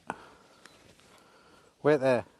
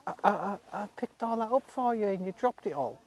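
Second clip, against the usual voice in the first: much higher, sing-song mock reproach to the horse; mock scolding pet talk; 7 semitones higher than usual. Real, not acted. To the horse that dropped its food, high mock scolding.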